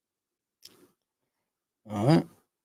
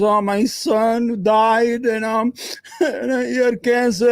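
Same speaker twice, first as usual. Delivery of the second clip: higher, slowed mock voice of an old president; mocking Biden; 6 semitones higher, slower than usual. Ranting streamer mocks the president's grief, higher, slowed mock voice. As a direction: ham up a grieving old man, half laughing. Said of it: "yo, I'm gonna talk like that".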